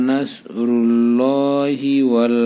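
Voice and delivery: slow chanted recitation with long held vowels; Quran recitation. Shown as a demonstration. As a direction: recite it slowly in tajweed style. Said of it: "এক আলিফ টান দিবেন".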